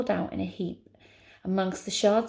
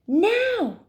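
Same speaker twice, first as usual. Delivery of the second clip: very high, loud drawn cry; urgent shout; 13 semitones higher, 8 dB louder than usual. Acted. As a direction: shout it with all your force. Heroine shouts while pushing.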